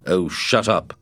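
mild annoyed outburst; a touch of irritation, called out; annoyed cry. A narrator's reading. Scott snapping at Matt in the dark, mild annoyed cry. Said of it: "Scott cried through the darkness".

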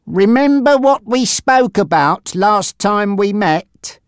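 high, slow, pointed question; menacing gangster Frankie. Acted. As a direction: press him with a slow, heightened threat.

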